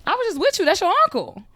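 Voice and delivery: high, loud, alarmed disbelief. Retold or imitated. Daughter to her mom about the uncle, alarmed and high. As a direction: voice jumps up in panic.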